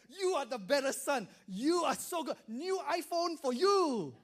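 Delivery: shower the good son with praise; a thrilled father rewarding his son; delighted dad. Retold or imitated.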